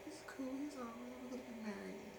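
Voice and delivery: high, soft mock-feminine voice; imitating his partner. Retells partner's replies in role-play.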